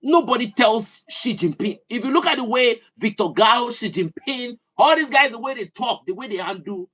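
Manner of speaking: rally the listeners at full force; fiery rant. Real, not acted. Impassioned political rant.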